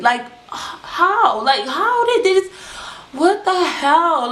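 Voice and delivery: disbelief. Real, not acted. Shock at teacher's pregnant fiance.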